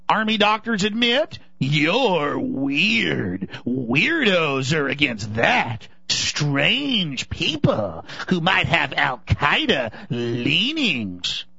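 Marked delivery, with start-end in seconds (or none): 1.6-11.4 sly official. Pretend. Parodying officials labelling critics.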